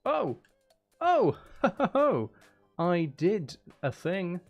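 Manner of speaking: ordinary talk, nothing marked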